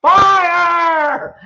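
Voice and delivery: full-out, high-pitched scream of alarm; demonstrated scream. Shown as a demonstration. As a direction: scream it long, as if warning of flames.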